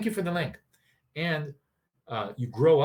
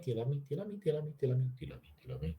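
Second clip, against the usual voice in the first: softer and softer repeated question; fading pleading; 8 dB quieter, much faster than usual. Pretend. Anxious partner asking daily, quieter and quieter. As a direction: nag until it dies away.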